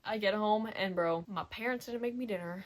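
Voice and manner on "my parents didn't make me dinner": normal talking voice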